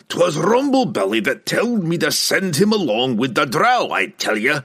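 high-pitched, indignant dwarf voice; blustering dwarf protest; angry dwarf. A narrator's reading. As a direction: insist hotly as a cornered dwarf. Bruner roars on in the quarrel.

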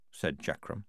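usual speaking voice

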